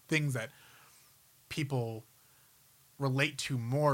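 ordinary talk

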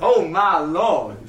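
drawn out Southern "Oh my lord"; slow Southern drawl. Retold or imitated. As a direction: stretch it like a Southern man. Repeats the Southern quote slowly.

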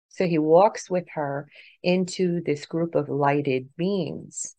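usual speaking voice

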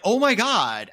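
high, loud, drawn-out dismay; reliving the shock of the moment; retold shock. Player reacting to a traumatic game twist, shocked.

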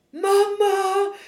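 high, loud cry for mum; retold scream. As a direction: yell out for your mother, re-enacting it. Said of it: "Så jag bara skriker". (återgivet skrik efter mamma)

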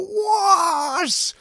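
high, loud, drawn-out "what"; hugely exaggerated, stretched squawk of shock; incredulous outburst. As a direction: shriek it in mock disbelief. Mock reaction to fifty hours.

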